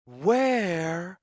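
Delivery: plain reading voice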